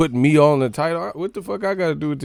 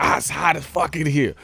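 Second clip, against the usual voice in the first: complaining guest; 5 semitones higher, 4 dB louder than usual. Retold or imitated. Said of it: "they be like".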